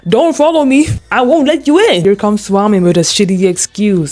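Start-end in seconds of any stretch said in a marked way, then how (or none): 0.1-2.0 higher mocking voice; voicing Khan shutting him out; mock character voice. Khan telling Swami off, higher mock voice. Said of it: "He said".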